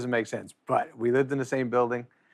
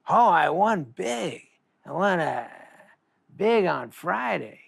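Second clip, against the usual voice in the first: slow, higher, drawn-out Norm voice; slow imitation of a buddy gloating; Norm impression; 6 semitones higher, slower than usual. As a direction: stretch it out like Norm bragging lazily. Norm quoted about his winnings.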